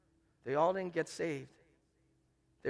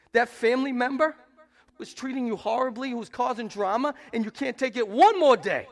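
loud, high preaching, building hard; full-force preaching; 7 semitones higher, 9 dB louder than usual. Acted. Preacher drives the point home to the congregation, loud and high. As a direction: preach it out with fire.

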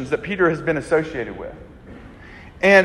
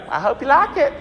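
much higher put-on old country voice; country grandfather; 9 semitones higher than usual. Friend's grandfather offers possum at dinner, high put-on country voice. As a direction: play the teasing old grandfather. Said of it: "he's like".

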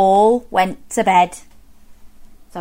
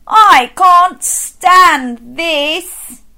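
very slow, louder, drawn-out exasperated groan; fed-up bear; 7 semitones higher, 6 dB louder, much slower than usual. Acted. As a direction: stretch every word like a worn-out bear. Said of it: "said Mister Bear".

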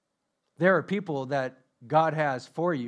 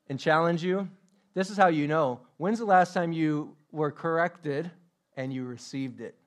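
ordinary talk

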